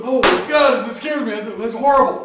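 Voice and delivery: high and loud imitating a scared gasp; mock fright. Mimicking a client scared of the book cover, a hammed-up frightened cry. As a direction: ham up someone spooked by a picture.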